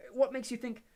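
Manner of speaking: high, bright schoolgirl; Hermione voice. A narrator's reading. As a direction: clever young witch voice.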